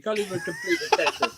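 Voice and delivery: high laughing tease; cracking up mid-sentence. Real, not acted. Teasing friend while laughing.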